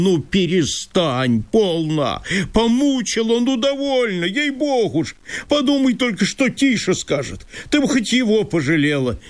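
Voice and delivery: high, agitated landowner in disbelief; Chertopkhanov voice. Acted. Landowner reproaching Masha for leaving, high and fretful.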